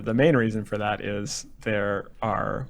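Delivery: normal talking voice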